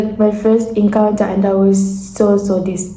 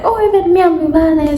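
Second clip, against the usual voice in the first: high, delighted imitation of a man; excited; 8 semitones higher, faster than usual. Man learning they share a tribe, imitated excitement. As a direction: jump up in pitch, imitating his excitement. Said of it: "So then he gets excited".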